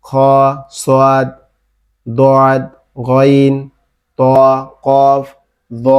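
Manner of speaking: খ, সদ, দদ, গইন, ত, কফ, দ said full and heavy; thick heavy letters. Shown as a demonstration. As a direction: thicken each Arabic letter from খ to দ. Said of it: "মোটা করে উচ্চারণ করতে হবে".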